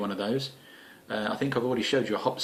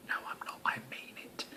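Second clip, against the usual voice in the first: conspiratorial whisper, much softer; 15 dB quieter, faster than usual. Acted. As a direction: breathe it as a secret aside. Whispered taking back the joke.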